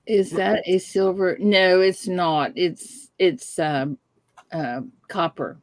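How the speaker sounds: usual speaking voice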